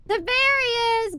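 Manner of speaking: high drawn-out name; excited call. Real, not acted. Greeting chatter by calling out.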